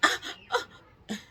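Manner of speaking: small clipped "ah" gasps; pained yelps. Retold or imitated. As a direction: imitate still flinching in pain. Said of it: "I'm still like".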